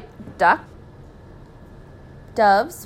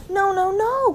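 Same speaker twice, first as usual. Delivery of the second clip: high, louder cry of protest; calling out in panic; 12 semitones higher, 5 dB louder, slower than usual. A narrator's reading. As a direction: call out a desperate plea, voice pitched way up. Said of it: "called out Mister and Missus Gregg both together".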